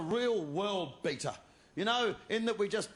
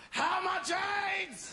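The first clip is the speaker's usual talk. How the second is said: high, slow, laughing shouted question; mock shout; 10 semitones higher, much slower than usual. Buddy joining in on air, laughing mock shout. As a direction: bellow it gleefully as the follow-up. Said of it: "I will run on after you and go".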